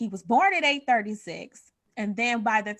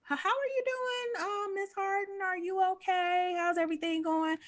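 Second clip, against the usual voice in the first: much higher, gentle caring nurse voice; nurse asking; 10 semitones higher, 4 dB quieter than usual. Retold or imitated. Nurse at postpartum visit asking the mother, in a high gentle voice. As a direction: ask softly like a concerned nurse. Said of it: "they were like".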